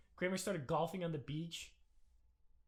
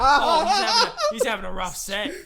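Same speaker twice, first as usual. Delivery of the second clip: high, loud, laughing impression; laughing mock voice; 10 semitones higher, 18 dB louder than usual. Podcaster mimicking a comic's thoughts, laughing loudly. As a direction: laugh through a mock sympathetic line. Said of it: "he's like".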